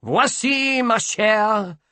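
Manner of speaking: high, bright burst of triumph; gleeful. A narrator's reading. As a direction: fling it out exultantly. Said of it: "exhibiting a sudden energy".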